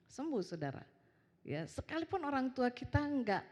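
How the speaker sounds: ordinary talk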